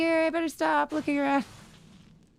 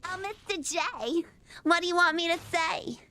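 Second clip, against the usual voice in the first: Harley Quinn. Acted. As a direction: coo it like a little flapper. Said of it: "Do a Harley Quinn impression".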